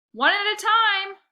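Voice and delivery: put-on loud, slow call over the crowd of kids; shouting. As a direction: raise the voice high and slow to keep order. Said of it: "I shouted".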